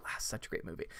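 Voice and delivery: breathy whispered sigh; whispered aside. Real, not acted. Streamer whispering fondly about Spaceballs after quoting it.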